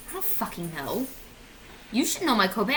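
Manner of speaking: ordinary talk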